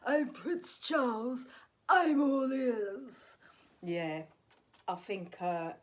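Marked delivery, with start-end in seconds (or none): 0.1-3.4 a slow, light royal drawl, higher; Prince Charles impression. Comic impersonates Prince Charles for the audience. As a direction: send up the king in his plummy tone. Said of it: "everyone could do Charles".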